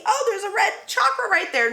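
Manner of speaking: hammed-up excited discovery; mock amazed discovery. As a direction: act out spotting something amazing. Acted mock discovery of a chakra.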